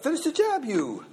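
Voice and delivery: Hawaiian Pidgin word order, verb thrown high up front; Hawaiian Pidgin English. Shown as a demonstration. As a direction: Hawaiian Pidgin demo, high pitched Finish. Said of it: "kind of a Hawaiian English".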